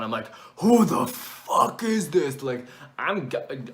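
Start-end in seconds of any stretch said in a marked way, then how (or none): 0.6-2.4 high, loud, drawn-out outraged yell; retold scream. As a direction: reenact yelling into the phone, stretching the words. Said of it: "I started screaming into the phone".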